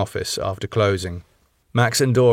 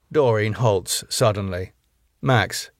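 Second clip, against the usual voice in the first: plain reading voice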